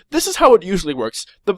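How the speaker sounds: ordinary delivery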